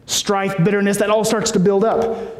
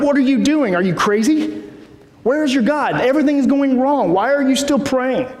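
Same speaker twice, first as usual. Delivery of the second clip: higher-pitched, disbelieving mock challenge; incredulous scolding; 6 semitones higher than usual. Onlookers mocking the praying psalmist, incredulous higher voice. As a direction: voice the doubters questioning him. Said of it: "everyone around him is like".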